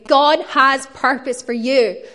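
loud, building to slow, weighty words; emphatic declaration. Acted. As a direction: proclaim it straight at each listener. Preacher acts out emphatic personal declaration.

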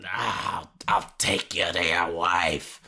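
Nick Nolte impression. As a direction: growl it low like Nick Nolte. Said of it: "love impersonating his voice".

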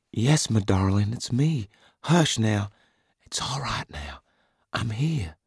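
put-on calm him like a frightened child; soothing woman. A narrator's reading. Wife soothing him after nightmare.

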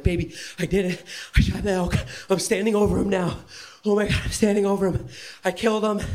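fast, high, breathless thrill; high, quick giddy report; excited. Retold or imitated. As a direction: rush it out, overjoyed. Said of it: "And I was like".

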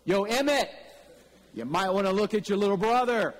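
pointing out the little brother to Emmett, called out; mock call out. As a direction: hail Emmett across the lawn, half joking. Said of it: "I almost turned around and said".

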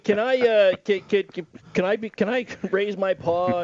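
lightly voiced timid cat, stammering. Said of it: "The paw raise".

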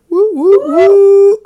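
high, loud whooping cheer; excited cheering. Real, not acted. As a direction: cheer them on wildly. Cheering a viewer's win.

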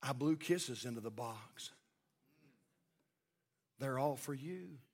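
hushed, low, tender voice; tearful little girl, imitated. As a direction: answer very softly with pauses. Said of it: "looked up at him with tears in her eyes".

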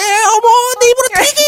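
shrieking, high, loud housewife fuss; hammed-up scandalized squeal; gossipy ajumma. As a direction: overwrought busybody wail. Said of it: "그 아줌마들 그래".

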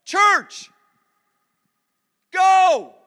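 high, loud shouted command; rallying shout. Real, not acted. Preacher urging the church to go, shouting. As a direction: fire up the room.

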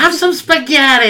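high, loud Brooklyn grandma voice; doing Grandma with a Brooklyn accent. Retold or imitated. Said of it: "with a Brooklyn accent".

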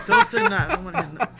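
high, loud laughing don't say that; laughing protest. Real, not acted. Woman begging a friend to stop, laughing high and loud. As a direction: squeal it out between laughs.

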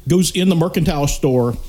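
ordinary delivery

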